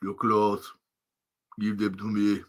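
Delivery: a hint of Arnold's flat robot voice; deadpan machine; Terminator impression. Mimicking the Terminator, lightly voiced. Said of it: "Arnold Schwarzenegger Terminator impression".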